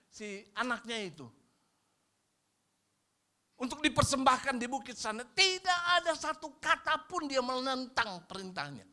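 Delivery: high, loud preaching; fiery preaching. Acted. Pastor urges the congregation to own mistakes, fiery and high. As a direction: drive the point home.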